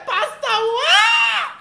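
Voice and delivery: high, loud shout at the preacher; church call-out. Retold or imitated. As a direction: cry out as the spirit moves you. Churchgoer calling out during sermon.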